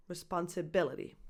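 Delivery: responsibility with the BI stressed, said softly. Shown as a demonstration. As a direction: quietly model responsibility with stress on BI. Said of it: "primary stress is on that B I".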